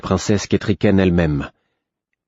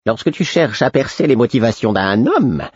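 sly, riddling fool's counsel; 4 semitones higher than usual. A narrator's reading. The Fool's parting advice.

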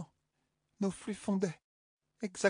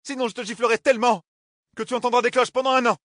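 much higher, louder threat; shrill threat; 7 semitones higher, 8 dB louder than usual. Acted. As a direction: shriek the threat. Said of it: "couina-t-elle comme une truie". (menace criée, aiguë)

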